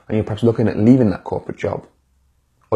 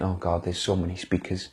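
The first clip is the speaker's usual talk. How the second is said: hushed, worried self-doubt; softer murmur of self-doubt; doubting inner voice; 4 semitones lower, 8 dB quieter than usual. Retold or imitated. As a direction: mutter it like a nagging voice in your head. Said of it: "that voice in your head thinking".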